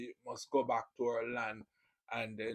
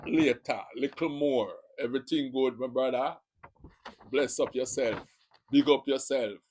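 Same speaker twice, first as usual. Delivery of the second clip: louder, hearty Jamaican patois sign-offs; Jamaican patois greetings; 7 dB louder than usual. Shown as a demonstration. Vlogger showing viewers Jamaican patois greetings, loud and hearty. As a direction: belt out Jamaican street greetings. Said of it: "I'm giving you a little touch of Jamaica".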